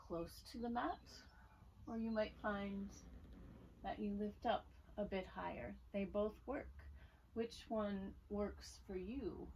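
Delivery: calm, unhurried instruction with long pauses; guided yoga voice. Real, not acted. Yoga teacher easing students into Sphinx pose, calm guiding voice. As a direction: softly guide them through the pose, leaving room to breathe. Leads a gentle yoga practice.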